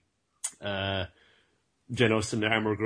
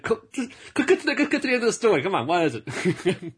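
impatient high voice; 7 semitones higher than usual. Retold or imitated. Saitama cutting the villain short.

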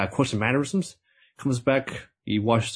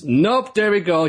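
louder, excited nope; 5 semitones higher, 5 dB louder, slower than usual. Real, not acted. Host revealing the girl's weirdness, louder and excited. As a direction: announce the twist with a jolt. Girl acts just as weird.

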